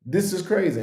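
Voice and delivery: usual speaking voice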